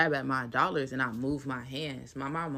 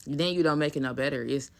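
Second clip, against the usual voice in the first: normal talking voice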